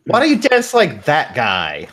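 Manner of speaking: put-on high tipsy girl voice; drunk party girl. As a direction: call it out like a teasing clubber. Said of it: "the girls you're dancing with are just like".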